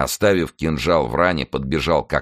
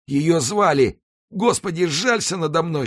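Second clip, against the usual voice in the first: high, strained voice of a dying man; faint desperate plea; 14 semitones higher than usual. A narrator's reading. Dying executioner begs God for mercy, high and anguished. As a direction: plead for mercy, pitched way up. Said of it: "шептал палач".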